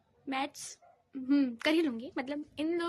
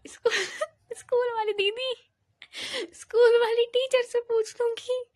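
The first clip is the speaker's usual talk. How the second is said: higher, louder laugh-choked words; 7 semitones higher, 7 dB louder than usual. Real, not acted. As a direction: talk through giggles. Laughing mid-sentence.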